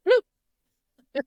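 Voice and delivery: high, loud cartoon bloop; squeaky mock chomp noise; imitated bloop. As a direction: pop out a silly high sound effect. Imitates head being bitten off.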